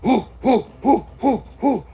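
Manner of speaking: loud, high rhythmic huffs; huffing effort. Retold or imitated. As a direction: huff hard up the hill. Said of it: "you're just like".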